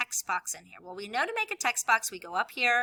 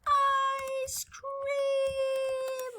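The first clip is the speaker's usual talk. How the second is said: excited cry; 3 dB louder than usual. Acted. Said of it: "I'm gonna make".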